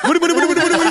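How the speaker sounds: high, loud panicked laughing protest; frantic laughing refusal. Retold or imitated. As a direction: yelp it out, overwhelmed and laughing. Retold panic at big order.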